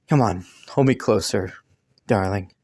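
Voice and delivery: tender. Acted. Asking partner to hold closer.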